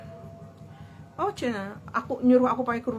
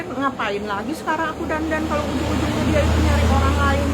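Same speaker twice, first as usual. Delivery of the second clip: higher, louder fed-up outburst; annoyed; 5 semitones higher, 6 dB louder than usual. Retold or imitated. Woman fed up after her boyfriend vanished, annoyed. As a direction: vent the resentment, half to herself. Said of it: "Saking jengkel gitu".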